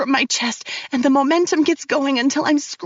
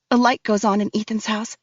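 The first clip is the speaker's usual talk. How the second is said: usual speaking voice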